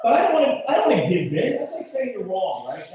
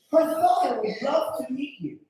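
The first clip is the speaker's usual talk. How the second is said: higher, put-on mother's voice; Missus Doubtfire; 7 semitones higher than usual. Mother sends the boyfriend to meet father, high matronly voice. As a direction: pitch it up as the lopsided-mouthed mother. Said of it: "she sounds like Missus Doubtfire".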